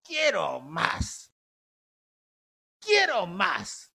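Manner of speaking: put-on slow, drawn-out whining child; whiny child. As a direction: stretch it out like a craving kid. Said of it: "Y el chiquillo decía". (niño quejumbroso, lento y arrastrado)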